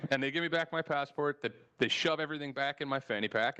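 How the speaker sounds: ordinary talk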